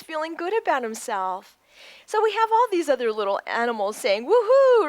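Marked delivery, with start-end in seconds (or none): 4.3-4.9 high, loud whoop; excited cheer. Acted. Animals in the story set off on a road trip, excited cheer. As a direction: whoop it out with glee.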